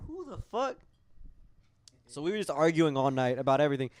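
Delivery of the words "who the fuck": higher, incredulous mock question; indignant. [name] reacting to her demand, higher and incredulous. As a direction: ask in pitched-up disbelief. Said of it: "And I was like".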